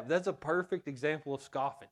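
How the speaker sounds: ordinary talk, nothing marked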